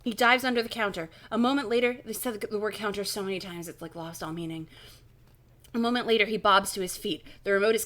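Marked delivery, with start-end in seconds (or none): none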